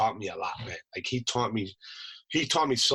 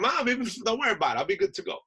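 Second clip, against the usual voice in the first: high, quick voice brushing off worry; hurried reassurance; 12 semitones higher, much faster than usual. Retold or imitated. Son to his crying mom before deploying, hurried high reassurance. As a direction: rush to calm a worried parent.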